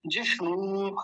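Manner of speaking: जिष्णु with the last vowel held; long final ū. Shown as a demonstration. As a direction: stretch the णू of जिष्णु. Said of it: "बड़े ऊ की मात्रा". (जिष्णु के अंत में बड़ा ऊ खींचकर)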